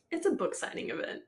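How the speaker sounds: said through a laugh; amused incredulous line. Real, not acted. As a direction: laugh as you say it. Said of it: "Bitch, you just met her".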